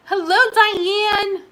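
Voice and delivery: high, loud, drawn-out hello; slow, high-pitched call of greeting; exuberant greeting. Real, not acted. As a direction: sing out a big welcome. Greets a viewer joining.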